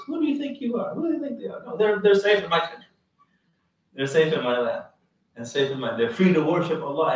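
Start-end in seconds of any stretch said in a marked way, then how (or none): 0.0-2.8 a hint of the defiant king, much higher; rising in lofty defiance; indignant king. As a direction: Najashi defends the Muslims, mildly indignant. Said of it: "just like the Najashi says".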